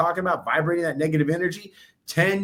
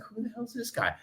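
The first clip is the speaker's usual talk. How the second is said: high, soft, incredulous muttering; mock coworkers; 6 semitones higher, 7 dB quieter than usual. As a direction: grumble like sleepy coworkers. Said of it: "everybody in there's like".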